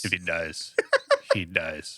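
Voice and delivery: cold deadpan repeat; a hint of a Russian boxer; menacing movie villain quote.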